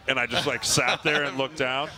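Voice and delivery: ordinary talk, nothing marked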